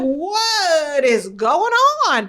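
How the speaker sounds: very slow, stretched-out mock disbelief; retold bewilderment. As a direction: drag out each word in exaggerated astonishment. Retold bewilderment at her son.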